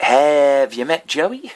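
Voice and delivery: slow, loud mock introduction with a long Have; retold pickup line. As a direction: HAVE drawn out loud and high, rising at Joey. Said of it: "uh, girl and went".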